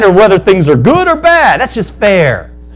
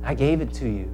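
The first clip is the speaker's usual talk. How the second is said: gentle giver; 7 semitones lower, 14 dB quieter than usual. Acted. Grace speaks to the believer.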